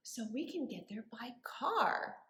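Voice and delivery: hushed whisper; whispered aside to the kids. Shown as a demonstration. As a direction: drop to a secret whisper. Teacher whispers to children.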